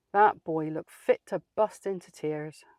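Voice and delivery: ordinary talk, nothing marked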